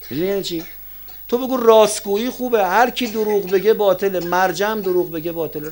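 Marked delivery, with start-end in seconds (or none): 1.3-5.7 high, raised preaching voice; emphatic preaching. Real, not acted. Preacher teaching listeners to judge truth from lies, emphatic and high. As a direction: lay down the rule from the pulpit.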